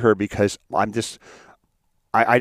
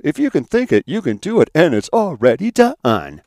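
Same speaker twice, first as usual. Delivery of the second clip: high, loud hammed-up guru voice; mocking motivational speaker; 6 semitones higher, 5 dB louder than usual. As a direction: parody a pumped-up self-help preacher. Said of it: "I don't like these motivational speakers".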